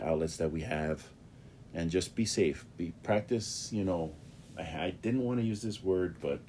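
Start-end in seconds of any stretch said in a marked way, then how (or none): none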